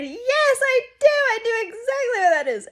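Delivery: high, loud overeager yes; mock eager agreement; fake enthusiasm. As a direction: bluff brightly to keep the talk going. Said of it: "so I said".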